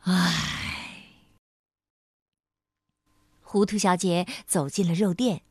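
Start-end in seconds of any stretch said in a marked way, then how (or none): 0.0-1.1 low resigned sigh; helpless sigh. Acted. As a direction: let out a weary, helpless breath. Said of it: "无可奈何地叹了口气".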